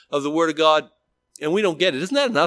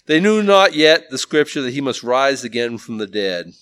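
louder, measured reading of the verse; scripture reading; 6 dB louder than usual. Acted. Preacher reads the verse to the men, solemn. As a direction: lay out the verse with weight.

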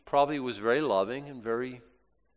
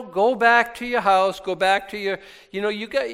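pleading Naomi; 7 semitones higher, 6 dB louder than usual. Retold or imitated. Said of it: "so she's like".

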